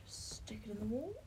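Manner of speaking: hushed whisper; quiet whispered grumble. Real, not acted. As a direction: mutter it under your breath. Whispering while pinning.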